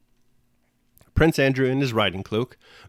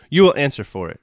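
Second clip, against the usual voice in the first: louder, harsh shouted threat; angry shout; 5 semitones higher, 8 dB louder than usual. A narrator's reading. Berg shouts threats at Prince Andrew, loud angry shout. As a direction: bark it as a threat of blame. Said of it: "shouted Berg".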